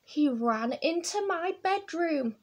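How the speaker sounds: usual speaking voice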